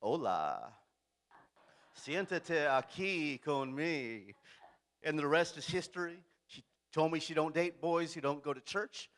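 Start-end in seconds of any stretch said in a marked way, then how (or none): 0.0-4.3 smooth pickup line. Retold or imitated. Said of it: "and I said".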